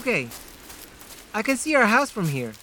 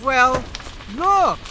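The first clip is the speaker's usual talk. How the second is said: shouting; 5 semitones higher than usual. Acted. Urgent shout.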